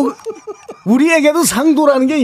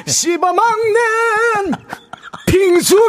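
high, long-held showy wail; throat-straining belting; 13 semitones higher, much slower than usual. Acted. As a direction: stretch it out like a rock vocalist warming up. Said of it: "목 조금 보호하시면 안 될까요". (목청껏 길게 내지르는 발성)

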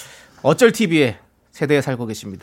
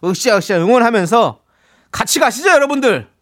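excited rallying call; 6 semitones higher, 4 dB louder than usual. Real, not acted. Host rallying listeners.

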